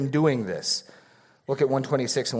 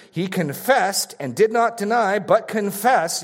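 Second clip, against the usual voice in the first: slow, drawn-out stress on "confessed" and "did not deny"; preacher hammering "confess" home; emphasis on confessed; 6 semitones higher, 3 dB louder than usual. Shown as a demonstration. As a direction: lean hard on "did not deny", stretching the words. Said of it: "You getting the emphasis?".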